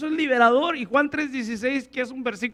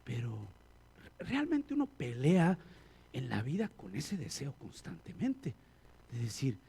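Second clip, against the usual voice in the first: usual speaking voice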